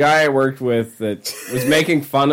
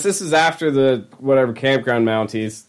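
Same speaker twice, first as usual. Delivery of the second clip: ordinary talk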